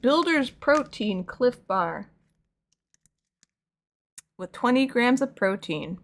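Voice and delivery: slow, deliberate reading of the label. Real, not acted. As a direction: read it out word by word.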